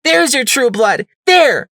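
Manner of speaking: high, very loud enraged yell; furious shout. Acted. Psycho Shy forcing Sunflower to see the ghoul, furious. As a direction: jab the words at her in fury.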